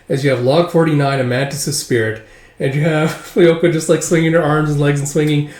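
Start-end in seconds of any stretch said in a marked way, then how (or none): none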